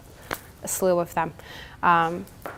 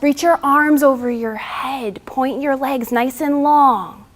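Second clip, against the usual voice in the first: guided relaxation; 2 semitones higher, 4 dB louder than usual. Acted. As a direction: talk them gently through each breath. Leads yoga cool-down and breathing.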